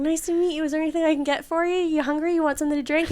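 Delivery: high, sweet, rapid offers; eager hostess. Retold or imitated. Retold hostess greeting shy young guest.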